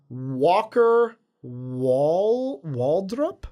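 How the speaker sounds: very slow, drawn-out sounding-out; unsure pronunciation. Real, not acted. Host reading a listener's surname, slow and unsure. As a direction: stretch the name out as you work out how to say it. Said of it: "I hope I said that right".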